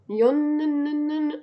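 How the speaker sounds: "يون" high, the N held on and on; drawn-out N. Shown as a demonstration. Teacher showing students the added letter, "يون" with a long N. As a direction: hum out the N ending "يون". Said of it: "يونيو بزود له".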